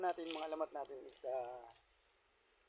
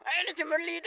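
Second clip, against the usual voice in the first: higher and louder call-out of the wife; loud announcement; 10 semitones higher, 6 dB louder than usual. Acted. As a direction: call her name out loud. Announcing wife character loudly. (malakas na pagtawag kay Merlita)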